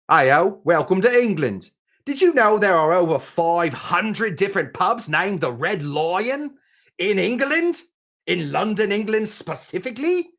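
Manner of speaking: mock English pub-greeter accent, pitch lifted high; hammed-up English tour guide. Cocktail host greeting viewers as a pretend Englishman, in a put-on English accent. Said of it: "I assume mostly for my English accent".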